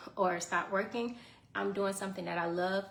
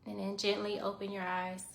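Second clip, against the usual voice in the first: guided meditation; 7 dB quieter than usual. Acted. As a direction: calmly guide the breathing. Leads a short guided meditation.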